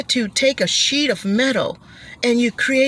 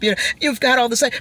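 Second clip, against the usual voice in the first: ordinary delivery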